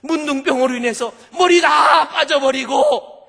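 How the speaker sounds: high, loud tearful shout; anguished pulpit exclamation; impassioned preaching. Real, not acted. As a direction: cry out each horror in rising waves. Preacher cries out a shocking description.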